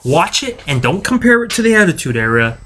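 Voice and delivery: ordinary delivery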